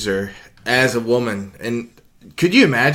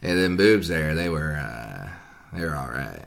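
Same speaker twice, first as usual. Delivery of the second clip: Matthew McConaughey impression; 10 semitones lower, 8 dB quieter than usual. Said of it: "let me give you my, uh, Matthew".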